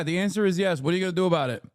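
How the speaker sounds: normal talking voice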